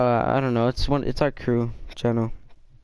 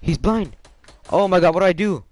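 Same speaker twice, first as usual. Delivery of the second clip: panicked; 8 semitones higher, 6 dB louder than usual. Real, not acted. Cornered by enemy, panicking.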